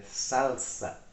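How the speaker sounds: slow "salsa" with a clear L, SAL-sa; Spanish pronunciation. Shown as a demonstration. Teacher shows the changed pronunciation, slow Spanish "salsa" with a full L. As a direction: draw out "salsa", tongue up for the L, not a W. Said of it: "que a pronúncia também muda".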